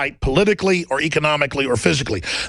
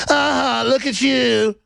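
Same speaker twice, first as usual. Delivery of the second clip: higher, drawn-out mock jeer; mocking ridicule; 5 semitones higher, much slower than usual. Media ridiculing people, mock jeering. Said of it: "they just think ridiculing people".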